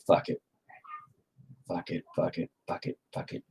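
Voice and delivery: breathy under-the-breath curses; whispered cursing. Real, not acted. Artist whispering curses at his own mistake. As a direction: swear quietly at the painting. Frustrated artist muttering to himself.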